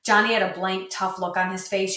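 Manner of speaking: ordinary delivery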